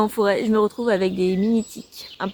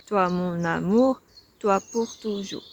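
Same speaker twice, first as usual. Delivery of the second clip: slow, low and soft scansion; counting the metre; 4 semitones lower, 6 dB quieter, slower than usual. Shown as a demonstration. Vlogger checks the poem's metre, low slow syllables. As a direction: spell out the verse syllable by syllable, quietly.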